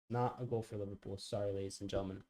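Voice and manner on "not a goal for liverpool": soft, resigned repeat; muttered repeat. Real, not acted. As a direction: let it drop under your breath.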